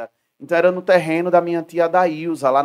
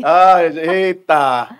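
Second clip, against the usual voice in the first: a long, louder "eita"; drawn-out surprise; 10 dB louder, much slower than usual. Real, not acted. Host reacts to the cabaret joke with a stretched, surprised "eita". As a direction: drag out the exclamation in mock shock.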